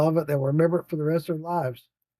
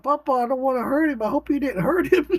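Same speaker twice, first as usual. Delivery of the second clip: put-on much higher, fretful child voice; scared little girl; 9 semitones higher than usual. Granddaughter to grandpa about the fish, fretful child.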